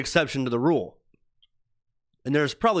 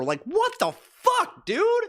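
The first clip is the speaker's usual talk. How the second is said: much higher, slow incredulous cry; can't believe what he heard; disbelief; slower than usual. Real, not acted. Told blood sacrifice conspiracy.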